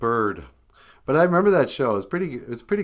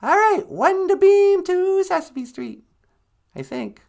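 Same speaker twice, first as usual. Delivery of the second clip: sweetie character; 6 dB louder than usual. Acted. Sweetie working the teleporter unsurely, high put-on voice. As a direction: call it out in a squeaky voice, then waver.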